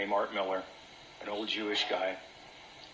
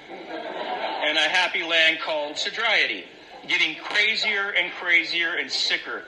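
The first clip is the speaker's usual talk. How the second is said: slow, laughing, higher and louder; laughing sarcasm; 5 semitones higher, 5 dB louder, slower than usual. Real, not acted. Laughing to the meeting about his own sober craziness. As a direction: laugh through the irony of it.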